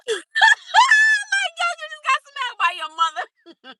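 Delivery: very high squealing laugh into words; high-pitched talk riding on laughter; helpless laughter. Real, not acted. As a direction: crack up, then squeeze the words out. Cracking up retelling the smack.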